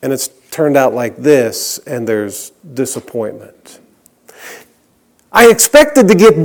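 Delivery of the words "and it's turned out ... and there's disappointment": much lower and much softer, sinking; dropping to a hush. Acted. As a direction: let the refrain fall away to almost nothing. Sermon refrain, dropping away.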